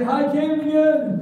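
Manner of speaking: loud, high greeting shout; big call-out to the viewer. Real, not acted. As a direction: shout it across the room to him. Pastor leads kids calling out.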